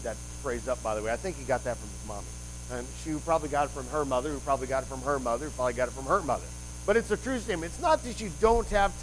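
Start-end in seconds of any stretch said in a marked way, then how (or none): 2.7-6.5 fast, repetitive rattle; rattled-off list. Real, not acted. Preacher traces a saying back through mothers, rattled off. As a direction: run through the chain quickly, each round alike. Comic chain of mothers passing it down.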